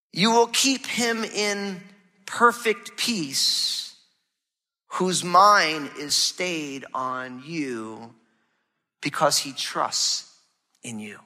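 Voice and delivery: slow, fading scripture reading. Acted. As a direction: read the promise slowly, letting it trail off. Pastor reads Isaiah 26:3 slowly.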